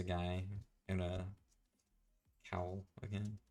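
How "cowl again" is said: normal talking voice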